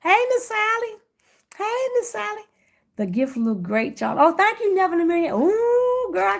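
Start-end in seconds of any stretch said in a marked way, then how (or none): 0.0-2.4 much higher sing-song hello; high bright sing-song hey; high sing-song greeting. Real, not acted. Greeting a friend on chat. 4.2-6.4 excited thanks. Real, not acted. Excited reply to compliment.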